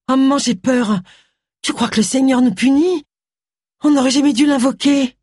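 mildly frightened. A narrator's reading. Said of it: "tremblante et délirante".

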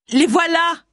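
cri très aigu, sans gros volume; cri d'alerte. A narrator's reading. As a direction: alerte quelqu'un d'une voix haut perchée. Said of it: "lui cria-t-elle". (crié dans l'aigu)

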